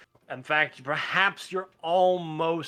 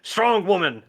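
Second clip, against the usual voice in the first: barbarian woman; 5 semitones higher, 5 dB louder than usual. Acted. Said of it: "Barbarian woman voice".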